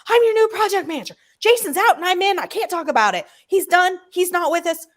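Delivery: high, loud, brash phone voice; loud screaming. Retold or imitated. New project manager shouting down the phone. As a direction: bark the news at them like a loud boss. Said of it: "I mean, screaming".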